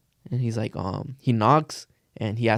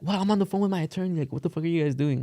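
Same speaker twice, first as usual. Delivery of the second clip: higher, fast huffy protest; indignant therapist; 6 semitones higher, 4 dB quieter than usual. Retold or imitated. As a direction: play the caught therapist, high and fast.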